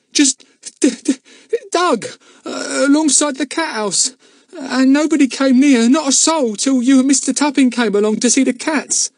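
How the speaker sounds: put-on frightened boy pleading his innocence; tearful stammer. A narrator's reading. Luke defends himself to Mister Goon, close to tears.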